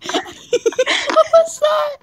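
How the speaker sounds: high, slow startled cry. Real, not acted. Reader hears a noise in the sink, startled high cry. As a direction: jump at a sudden noise.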